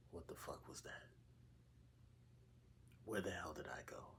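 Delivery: hushed, bewildered whispering to oneself; stunned whisper. Retold or imitated. Dreamer replaying the moment he woke up, whispering in bewilderment. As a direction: whisper it low, dazed and disbelieving. Dazed reaction on waking up.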